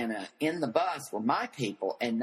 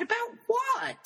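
outraged shout. Retold or imitated. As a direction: fire it back in disbelief. Shocked retort in retold row.

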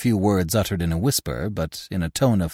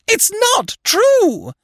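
very high, slow, loud scream; 5 dB louder, much slower than usual. A narrator's reading. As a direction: scream it again, still higher. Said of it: "she screamed".

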